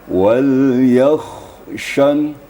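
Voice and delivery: "wal yakhshan" very slow, kha finished before shin; slow careful recitation. Shown as a demonstration. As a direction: calmly recite "wal yakhshan", every letter whole. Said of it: "ini ditenang-tenangkan".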